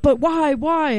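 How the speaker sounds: high nagging whine; whiny why. Retold or imitated. Pushy customer pestering the dancer, high whine. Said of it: "but he's like".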